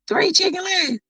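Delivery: high, shocked shout; high astonished cry; shocked exclaim. Real, not acted. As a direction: blurt it out in disbelief. Reacting to comment, shocked.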